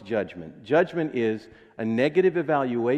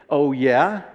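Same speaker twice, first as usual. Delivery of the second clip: defensive retort; 6 dB louder than usual. Retold or imitated. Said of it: "it's usually".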